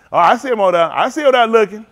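ordinary delivery

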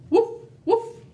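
high, loud woof; dog bark. Acted. Teacher voicing Dog in the story, loud high woof. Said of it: "said Dog".

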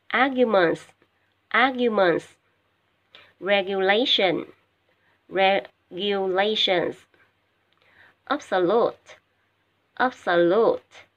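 teacher modelling each word twice, loud and careful; a teacher drilling English vocabulary aloud; slow, loud model reading. Shown as a demonstration. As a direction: read out slowly for students to repeat. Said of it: "nghe cô đọc ha và lặp lại theo cô nha".

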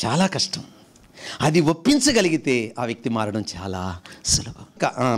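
ordinary talk

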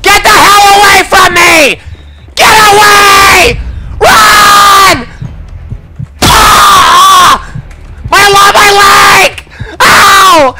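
high, loud terrified shouting; panicking gamer. Real, not acted. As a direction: scream as you're caught. Being chased and caught.

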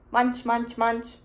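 playful chewing noises; munching sounds. Acted. Eating sound effects.

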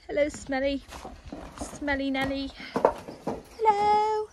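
high sing-out hello to a pet; cooing pet talk. Real, not acted. Greeting Smelly Nelly the puppy, cooing. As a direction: coo at a beloved puppy, pitch climbing.

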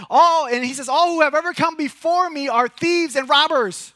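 high, loud preacher's proclamation; proclaiming scripture. Acted. Pastor reading Jesus's words to the church, loudly proclaimed. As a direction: call it out to the whole room.

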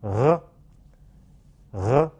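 "呃" as a French r from the uvula; uvular trill demo. Shown as a demonstration. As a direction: say "呃" twice, isolated, throat relaxed. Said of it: "请大家跟我读".

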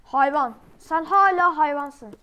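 loud, drawn-out insult; shouted insult. Real, not acted. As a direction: hurl the insult loudly. Insulting someone in game.

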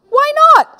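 very high, loud question; incredulous coaxing. Acted. Older brother pushing his brother to try it, high incredulous question. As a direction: press him in a high loud voice.

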